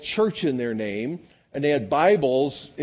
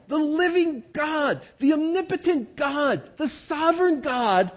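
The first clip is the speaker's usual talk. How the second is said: slow, rising to a high ringing shout on each God; full-force preaching; 9 semitones higher, slower than usual. Acted. Pastor to congregation, exalting God's word, slow high preaching shout. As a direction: build it up like a pulpit crescendo.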